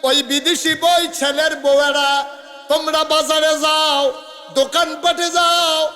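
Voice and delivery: loud, high melodic preaching; full-force sermon. Acted. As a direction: chant it out to the crowd, drawing out line ends. Preacher scolds women in crowd.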